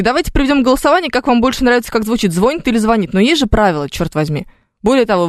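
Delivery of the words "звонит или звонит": "звонит" stressed first on the first syllable, then on the last; two stress variants. Shown as a demonstration.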